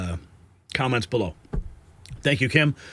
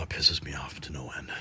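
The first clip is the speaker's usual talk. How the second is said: whispered through real frustration, much softer; exasperated whisper; 13 semitones lower, 11 dB quieter than usual. Real, not acted. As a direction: let the anger sink into a breathy whisper. Fuming about low viewer numbers.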